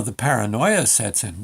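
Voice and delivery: ordinary delivery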